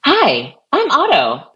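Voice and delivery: high, bright-pitched assistant greeting; second Otto voice option. Shown as a demonstration. Auditioning another assistant voice for colleagues, high-pitched. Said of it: "I'll give you three options".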